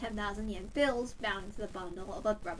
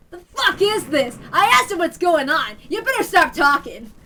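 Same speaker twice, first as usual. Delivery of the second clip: loud, higher, raging threats; furious yelling; 5 semitones higher, 13 dB louder than usual. Acted. Man raging at stammering newcomers, loud furious yelling. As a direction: let the rage boil over. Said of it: "His angry voice echoed through the shop".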